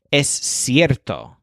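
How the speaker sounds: Es cierto slowly, weight on cier, sounding like air; slow Spanish es cierto, cier stressed. Shown as a demonstration. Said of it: "but with a stress on air".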